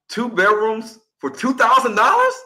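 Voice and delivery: retold astonishment; incredulous listener. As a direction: echo the rent in disbelief. Retold shock at Brooklyn rent.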